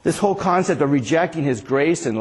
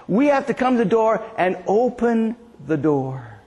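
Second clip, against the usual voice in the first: ordinary talk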